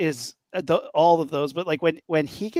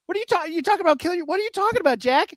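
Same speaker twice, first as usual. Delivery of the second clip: faster and higher with each repeat; rising mock disbelief; 12 semitones higher, much faster than usual. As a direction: push the disbelief higher every time. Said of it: "And I'm like".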